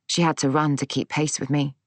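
usual speaking voice

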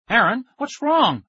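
high, loud, drawn-out panicked call; alarmed cry. A narrator's reading. Kid hears his friend scream on the phone, alarmed cry. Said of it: "I cried".

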